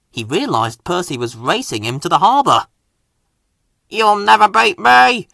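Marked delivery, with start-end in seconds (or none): 3.9-5.3 loud, high boastful helicopter; proud. A narrator's reading. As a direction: crow it proudly. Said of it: "he said proudly".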